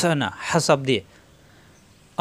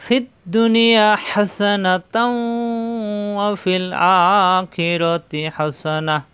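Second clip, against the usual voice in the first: slow, higher, drawn-out chanted verse; Quranic recitation; 3 semitones higher, much slower than usual. Shown as a demonstration. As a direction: intone the whole dua in long sung phrases.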